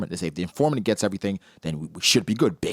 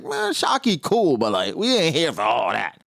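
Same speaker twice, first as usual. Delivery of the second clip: mock crew voice; 6 semitones higher than usual. Crew shrugging off Shaki.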